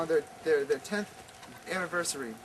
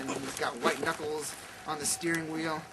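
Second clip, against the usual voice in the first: ordinary talk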